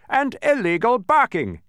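slow, high, loud added charge; officious accusation. A narrator's reading. Policeman charging the watchdog, loud accusation. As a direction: tack it on loudly and deliberately.

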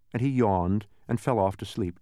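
ordinary delivery

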